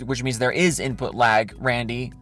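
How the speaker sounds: low, pointed "there IS input lag"; stress on is. Real, not acted. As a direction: spell it out, pressing "is". Final gotcha to Randy.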